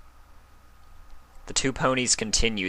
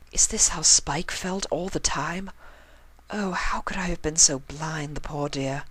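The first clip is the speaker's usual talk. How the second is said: higher, refined pony lady voice, thinking aloud; Rarity's voice; 9 semitones higher than usual. A narrator's reading. Rarity realising how Spike felt, higher refined voice.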